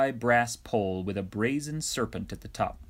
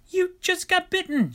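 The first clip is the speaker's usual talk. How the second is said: much higher, louder exasperated shout; 4 dB louder than usual. A narrator's reading. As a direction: yell it in disbelief. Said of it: "shouted Moses".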